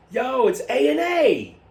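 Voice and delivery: high, slow hammed-up announcer; ad pitch. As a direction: sell it like a commercial. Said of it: "pitching a jingle".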